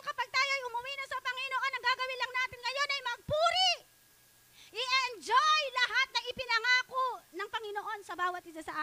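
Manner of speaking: high, loud preacher's call to the congregation; fervent preaching. Acted. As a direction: preach it at full force, rising. Preaching at full force. (masigasig na pangangaral nang malakas)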